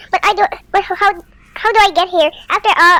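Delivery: ordinary delivery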